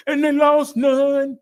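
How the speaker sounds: high, loud and slow, crowing it; triumphant catchphrase. Acted. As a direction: sing out the good news like a victory call. Catchphrase on zero deaths.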